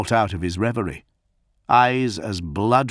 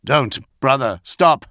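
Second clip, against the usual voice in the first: high, loud alarmed shout; cried out in alarm; 5 semitones higher, 6 dB louder than usual. Acted. Gilliman crying out to his raging brother in alarm. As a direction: shout to stop him, alarmed. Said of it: "Gilliman cried out in alarm".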